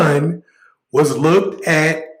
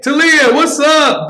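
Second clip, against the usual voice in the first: excited greeting; 10 semitones higher, 5 dB louder, slower than usual. Real, not acted. Greeting a viewer on stream.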